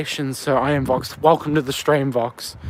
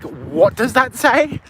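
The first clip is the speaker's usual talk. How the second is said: slow, high, puzzled question; baffled reading; 6 semitones higher, slower than usual. Retold or imitated. Streamer puzzling over a viewer's username, baffled. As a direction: drag it out in mock bafflement. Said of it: "I read your name very wrong".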